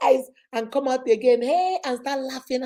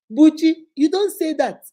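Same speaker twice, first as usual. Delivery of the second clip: firm, louder mock telling-off of a child; scolding son; 5 semitones higher, 7 dB louder than usual. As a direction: scold the boy, raising the voice. Mother scolding son in story.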